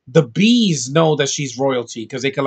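normal talking voice

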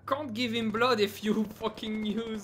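frustrated. Real, not acted. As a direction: snap at a careless teammate. Medic annoyed at teammate.

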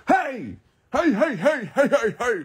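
squealed, rhythmic run of heys; high-pitched calling. Real, not acted. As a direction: call out in a high, swooping voice.

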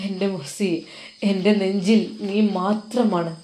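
usual speaking voice

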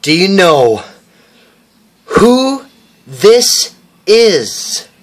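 slow, drawn-out announcer tease; dramatic slow build. Acted. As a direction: stretch who this is out slowly. Kid repeats it dramatically.

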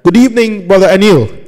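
high, loud, rousing hello; energetic radio-host welcome; excited greeting. Shown as a demonstration. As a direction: pump up the guest with a big greeting. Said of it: "let's try that one more time".

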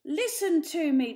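loud commanding call for order; demanding. A narrator's reading. Old leader silencing the family, loud and demanding. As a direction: seize the room's attention. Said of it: "demanded the elder Poor".

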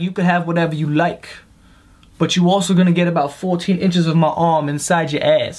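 lightly voiced boyfriend, somewhat low; a hint of a crude sweet-talker; imagined boyfriend. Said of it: "I bet he's saying some shit like".

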